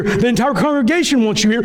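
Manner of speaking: ordinary talk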